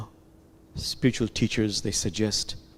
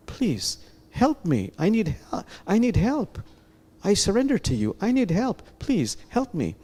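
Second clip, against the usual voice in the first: higher, pleading prayer; 9 semitones higher than usual. Shown as a demonstration. Teacher demonstrating a prayer to God, pleading higher. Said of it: "You can make it a prayer".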